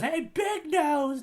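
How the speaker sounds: slow, high taunt; mocking Luffy. Host quotes Luffy taunting Buggy, slow and high. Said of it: "he goes".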